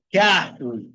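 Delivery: "carro" with RR as a Spanish jota; double R as J. Shown as a demonstration. Teacher showing the double R rule, clear J-sound demo. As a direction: say "carro" like "cajo", stress on the first syllable. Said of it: "tem som também de J em espanhol".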